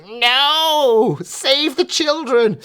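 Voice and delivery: high, slow mock-pearl-clutching squeal; high, drawn-out mock outrage; mocking prissy outrage. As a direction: squeal it like a scandalised critic. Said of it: "Polygon's gonna go".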